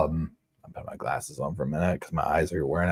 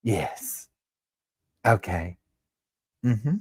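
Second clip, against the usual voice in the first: Heidi impression; 5 semitones higher, much slower than usual. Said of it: "I said".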